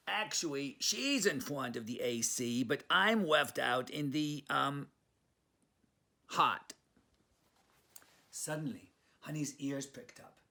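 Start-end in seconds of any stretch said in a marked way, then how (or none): 0.1-6.7 Brooklyn Chihuahua. Acted. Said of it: "says Leon".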